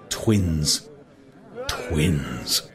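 plain reading voice

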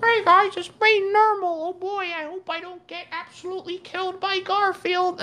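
very high, squeaky cartoon kitten voice; Nermal voice. Auditioning as the cartoon kitten Nermal. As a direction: chirp it like a timid cartoon cat. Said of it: "I'll say, the voice of Nermal".

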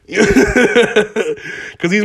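slow, laughing delivery; laughing it out slowly. Real, not acted. Joking rant, laugh heard.

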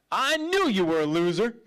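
loud, hammed-up accusing jeer; mock condemnation. What the Lord would never say when he messes up, loud mock scorn. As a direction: put someone down loudly, as a parody. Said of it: "the Lord doesn't speak like that".

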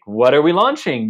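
imitated baffled question; baffled reaction. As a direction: voice a puzzled onlooker, loudly. Voices an imagined puzzled onlooker's reaction.

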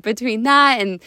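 ordinary delivery

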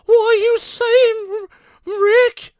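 very high, loud, worried stammer; Morty impression. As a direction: squeak it out like a panicky teenage sidekick. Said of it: "He's like".

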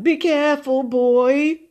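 nervous captive's high plea; anxious murmur. A narrator's reading. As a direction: urge the captor to take care. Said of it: "he murmured".

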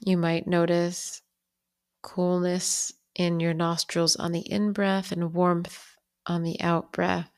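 unhurried meditation guide, long pauses between phrases; calm, even-pitched bedtime guidance with lingering pauses; guided bedtime meditation. Real, not acted. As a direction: ease the listener toward sleep, phrase by phrase.

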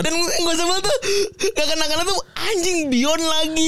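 laughing through a mock complaint; giggling while griping; being tickled. Real, not acted. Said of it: "Gue demi Allah geli banget". (sambil tertawa geli)

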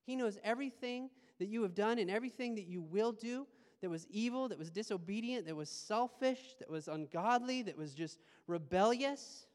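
ordinary delivery

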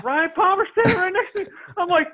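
high-pitched, laughing disbelief; amused laughing. Real, not acted. Storyteller revealing who stood beside him, laughing and high. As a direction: deliver the punchline through a laugh. Laughing at his own punchline.

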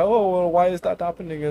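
high, concerned help desk voice; support agent voice. Retold or imitated. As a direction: ask like a sweet agent. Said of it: "He's like".

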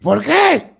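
high, loud "why?"; nervous comeback. Retold or imitated. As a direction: blurt it high and defensive. Retold nervous answer to police.

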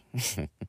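short, quiet snicker. A narrator's reading. Mother amused by her son's answer, quiet snicker. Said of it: "Grace snickers".

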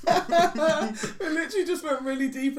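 high laughing through the words; nervous laughter. Real, not acted. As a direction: laugh nervously while talking. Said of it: "it's a nervous thing".

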